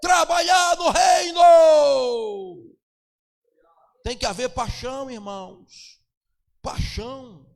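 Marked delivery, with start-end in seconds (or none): 0.0-2.7 loud, high fervent preaching; preaching at full force. Acted. Pastor rousing the congregation to evangelize, shouting with fervor. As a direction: shout the call to work like a revival preacher.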